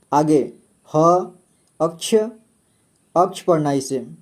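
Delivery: ordinary delivery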